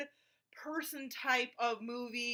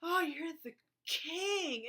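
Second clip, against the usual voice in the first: slow, swooning mock praise; hammed-up worshipping wife voice; mock adoring wife; 3 semitones higher, much slower than usual. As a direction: gush over him, dragging it out. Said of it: "his wife is".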